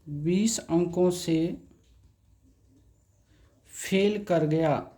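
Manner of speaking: each word drawn out, slow and even; बीस अंकों से said very slowly for students to copy; slow dictation. Shown as a demonstration. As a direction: spell it out slowly, like dictating to a class. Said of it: "teacher dictates slowly as he writes".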